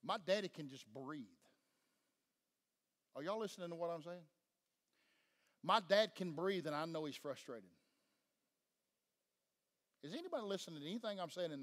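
hushed pastoral teaching; soft intimate preaching. Real, not acted. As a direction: draw the room in quietly.

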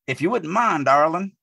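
a light Southern lilt, "darling" without the G; mildly hammed-up country charm; Southern drawl. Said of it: "he even dropped the G".

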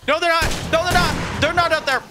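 high panicked denial. Real, not acted. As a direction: blurt it out in alarm. Panicked denial, zombies inside.

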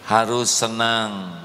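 ordinary talk

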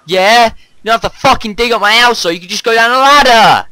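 yelling angrily, high and loud; furious yell at a friend; angry shout. Real, not acted. Angry at friend digging house.

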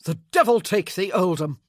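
loud angry outburst; grieving heir snapping at the official; snapped. Acted. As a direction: snap it out in fury. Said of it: "Michael snapped".

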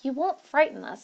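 ordinary delivery

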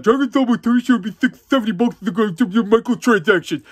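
mock commenter. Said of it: "I've seen say".

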